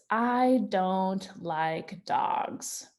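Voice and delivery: very slow, word by word; plain sentence read very slowly; slow reading aloud. Shown as a demonstration. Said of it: "and it says".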